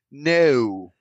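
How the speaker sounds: drawn-out British "no"; distinct British accent. Retold or imitated. Dad imitating daughter's Peppa Pig habit, drawn-out British accent. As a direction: imitate a child's British no, stretching the vowel. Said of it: "a very distinct British accent".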